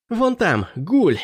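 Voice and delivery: high urgent warning; alarmed shout. A narrator's reading. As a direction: point it out urgently. Prince spots ghoul.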